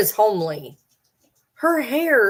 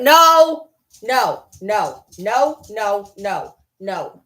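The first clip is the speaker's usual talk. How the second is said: sharp scold; 6 dB louder, much slower than usual. Real, not acted. Owner scolding her dog, loud and sharp. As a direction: bark it at the dog.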